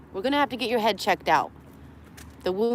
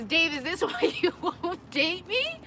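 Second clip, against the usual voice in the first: laughing; 7 semitones higher, 4 dB quieter, slower than usual. Real, not acted. Teasing Dave while laughing.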